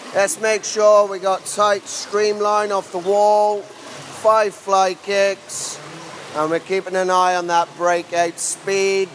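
loud, slow poolside coaching call. Real, not acted. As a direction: project across the pool to swimmers. Coach addressing swimmers on poolside.

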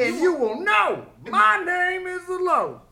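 high, slow, loud theatrical declamation; movie-quote impression. Topping friends' film impressions, high and declamatory. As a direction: draw out the film line, high and forceful.

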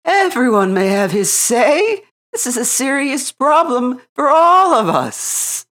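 high, loud council-leader owl. A narrator's reading. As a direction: speak as the wise owl chairing.

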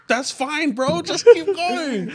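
high, slow laughing reassurance. Retold or imitated. Urging a friend to keep rapping, laughing high. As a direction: coax him on through laughter. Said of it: "And I was like".

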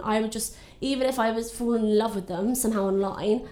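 ordinary talk

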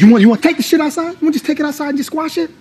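fast, high-pitched dare to fight; heated, rushed confrontation; angry challenge. Acted. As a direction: challenge him to step outside, rapid and high. Coworkers quarrel, challenge to fight.

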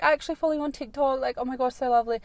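put-on high, fast gushing fan girl. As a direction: gush like a starstruck fan.

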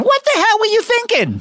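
high, put-on talk show host; Jay Leno impression. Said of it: "Jay Leno is going".

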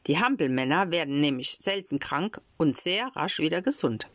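ordinary delivery

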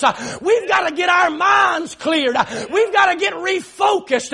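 driving, rhythmic Pentecostal-style preaching; fervent preaching. Real, not acted. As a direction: preach it at full force, punching each phrase. Preaching at full force.

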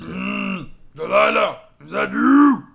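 booming, slow-witted ogre calling out; loud deep ogre voice. Acted. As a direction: bellow it like a dim giant. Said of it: "a loud, deep ogre voice".

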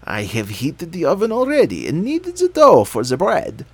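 put-on bossy old witch. A narrator's reading. Witch speaks.

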